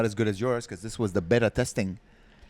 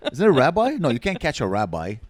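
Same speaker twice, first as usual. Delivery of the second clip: usual speaking voice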